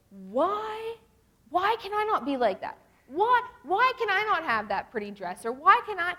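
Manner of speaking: high, mock-whiny pleading; hammed-up jealous lament; envious complaint. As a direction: wail it in exaggerated self-pity. Mimicking envious self-pitying whine.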